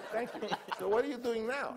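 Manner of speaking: put-on high old mogul voice with laughter; Sam Goldwyn impression. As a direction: ask it like the boss, laughing through it. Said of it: "he talked like I'm gonna talk".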